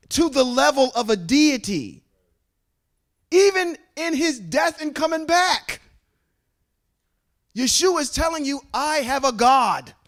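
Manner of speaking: high, loud preaching push; preacher driving home the point, loud and high; fiery preaching. Acted. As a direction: preach it hard to the congregation. Preaching at full force.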